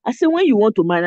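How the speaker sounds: Nigerian accent, quick and firm; Naija accent. Shown as a demonstration. To the American brothers on the live, in Naija accent. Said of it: "I was saying it like I'm saying it right now".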